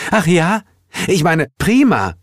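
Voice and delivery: high-pitched astonished character voice; surprised high exclamation; surprised, then pleased. Acted. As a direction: catch yourself and cheer in a high voice. Heel surprised they agree.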